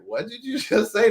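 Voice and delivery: high, amazed, half laughing; shocked disbelief. Retold or imitated. The mother stunned by her husband's apology, high and amazed. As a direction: she can't believe her ears.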